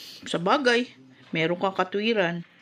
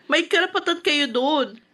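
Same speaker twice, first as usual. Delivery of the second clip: louder and higher, pressing hard; heated insisting; 6 semitones higher, 4 dB louder than usual. Acted. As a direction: argue her case with rising heat. Daughter insists on mother's rights.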